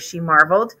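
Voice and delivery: ordinary delivery, nothing marked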